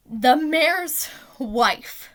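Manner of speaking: loud, drawn-out dismay; appalled disbelief. Acted. Actress reading the cast list, loudly appalled. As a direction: read it out slowly and loudly, aghast.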